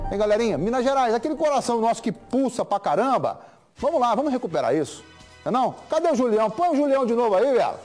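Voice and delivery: loud, high rallying appeal; rallying call. Real, not acted. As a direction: fire up the audience. Host rallies viewers, calling out.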